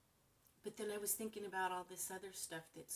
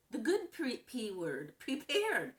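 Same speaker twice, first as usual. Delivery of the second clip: "good" and "prepared" said much higher and louder; stress on good and prepared; 5 semitones higher, 7 dB louder, slower than usual. Real, not acted. A woman repeating her motto to viewers, loud emphasis. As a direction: hit "prepared" like a slogan.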